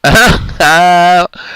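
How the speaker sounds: real laughter; high burst of laughter. Real, not acted. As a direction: crack up at a comment. Laughs at chat.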